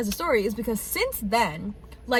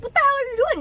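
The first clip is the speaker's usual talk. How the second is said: much higher, louder startled yell; startled at the piercer's unmarked needle; shocked outburst; 5 dB louder, much faster than usual. Retold or imitated. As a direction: catch him about to pierce, alarmed. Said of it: "And I'm like".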